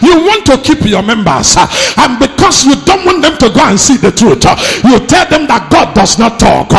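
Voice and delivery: loud, high-pitched sermon delivery; full-force preaching. Real, not acted. Preacher rebuking pastors through Jeroboam's story, full-force preaching. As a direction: preach it hard, pushing every phrase.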